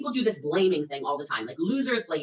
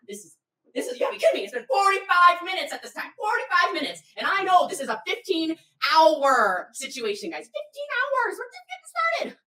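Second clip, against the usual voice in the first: high, heated exasperation; spicy; 9 semitones higher, 5 dB louder than usual. Retold or imitated. Organizer ranting forty-five minutes into the hike, spicy. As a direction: let disbelief build to a high-pitched squeal. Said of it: "I was getting a little spicy".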